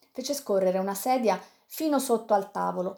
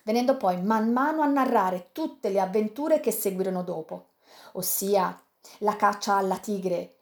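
usual speaking voice

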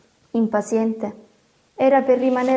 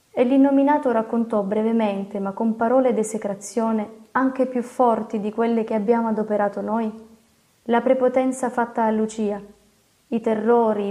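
usual speaking voice

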